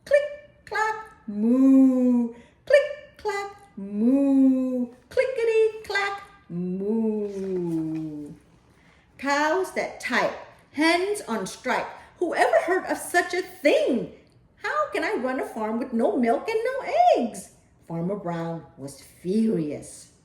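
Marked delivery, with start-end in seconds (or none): 0.0-8.3 bang out the clicks, then a loud moo; loud typing and mooing. A narrator's reading. 9.2-17.5 high, laughing disbelief; exasperated farmer. Acted. Farmer Brown ranting about the strike, high exasperated.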